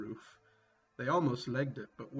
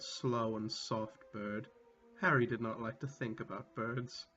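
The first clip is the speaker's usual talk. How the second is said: normal talking voice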